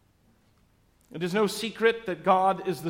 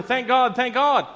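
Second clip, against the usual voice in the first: higher and louder, urging the horse on; a rider urging his horse on, louder; louder call; 7 semitones higher, 7 dB louder than usual. Acted. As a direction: call it louder each time, eager. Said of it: "he says even louder".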